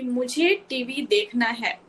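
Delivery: put-on "मुझे TV देखना है" said slowly, each word laid out for the child; slow teaching model. Shown as a demonstration. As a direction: model the full sentence slowly and clearly. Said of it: "उसको सिखाओ कि".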